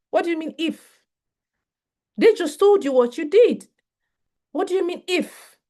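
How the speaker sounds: high, incredulous "What do you mean if?"; indignant challenge. Real, not acted. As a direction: push back on the "if" in disbelief. Challenging a conditional apology.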